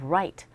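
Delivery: "right" with lips rounded for R; low "right", tongue touching nothing at the start; R sound. Shown as a demonstration. Teacher switches back from L, "right" on a rounded R. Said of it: "다른 발음을 구사할 수 있는".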